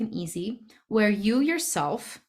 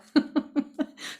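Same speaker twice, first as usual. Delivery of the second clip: short, high chuckle; high little giggle at yourself; self-conscious laugh; 8 semitones higher than usual. Real, not acted. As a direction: laugh lightly at your own confession. Laughs at own confession.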